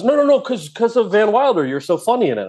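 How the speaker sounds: high, hurried mock backpedal; eager backpedaling. Screenwriter explaining himself to the offended actor, eager. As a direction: imitate him scrambling to explain, starting high. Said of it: "and he goes".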